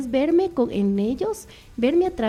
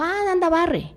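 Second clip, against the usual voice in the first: bossy order; 8 semitones higher, slower than usual. Shown as a demonstration. Harsh order to son as example.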